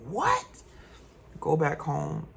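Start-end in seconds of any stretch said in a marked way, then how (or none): 0.1-0.6 shrieked high what; a mother's stunned high gasp of a question; shocked mom. Retold or imitated. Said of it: "My mama like".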